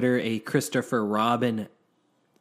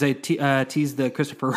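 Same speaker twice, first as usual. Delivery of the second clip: ordinary delivery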